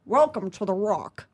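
Sean Connery impression. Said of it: "I did Sean Connery".

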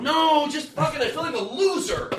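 high, slow, whining loser; whiny complaining. Retold or imitated. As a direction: moan it to friends. Imagined man complaining to friends.

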